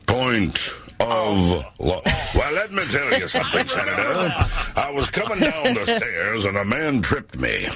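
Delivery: high, laughing mock courtroom witness; radio bit impression. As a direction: play the nervous witness from the old radio sketch. Imitating radio bit Point of Law.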